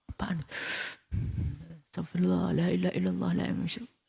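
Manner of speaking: high, hushed string of pious exclamations; softly aghast, pitched well above normal; sin-fearing gossip. Acted. Husband reacting to the neighbour's daughter, high hushed dismay. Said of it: "Kayaknya dia orang takut dosa dia".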